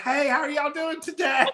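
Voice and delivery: high, hammed-up Southern hello; Southern shop greeting. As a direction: call out to a passing customer, Southern style. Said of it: "somebody would walk by like".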